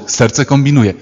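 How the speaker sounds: ordinary talk, nothing marked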